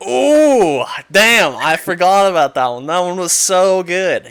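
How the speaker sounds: loud, high, thrilled; excited. Real, not acted. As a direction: burst out in delight. Sudden excited memory.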